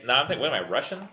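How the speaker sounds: ordinary talk, nothing marked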